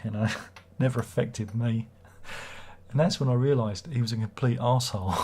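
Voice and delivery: ordinary delivery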